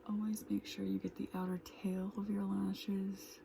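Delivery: quick soft whisper. Real, not acted. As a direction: murmur it while doing makeup. Whispered makeup chat.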